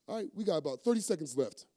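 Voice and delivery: ordinary delivery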